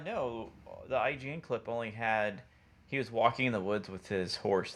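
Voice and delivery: normal talking voice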